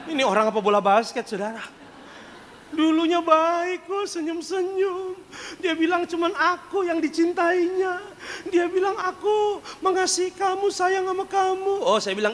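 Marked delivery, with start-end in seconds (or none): 2.7-11.9 high, slow sugary mock lover; mock sweet wife. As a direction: coo it like a fake-sweet suitor. Mimics sweet courtship talk. (suara manis pura-pura, tinggi dan lambat)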